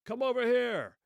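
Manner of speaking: put-on shouted in a German accent, high and loud; loud, high German-accented hail. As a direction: call across no man's land in a thick German accent. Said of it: "a voice with a thick German accent is said to have called out".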